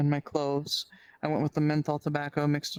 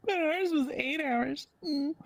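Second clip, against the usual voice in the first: much higher, slow fake sob; mock crying; 12 semitones higher, slower than usual. Joking back about a longer school day with fake crying. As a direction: whimper it like a hammed-up lament. Mock lament after joke.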